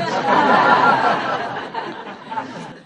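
a loud hearty burst of laughter. Real, not acted. Host laughing at the divorce joke.

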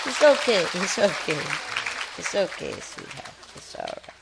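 hushed, fading reassurance to a scared bird; calm it down, voice sinking to a whisper; soothing. Real, not acted.

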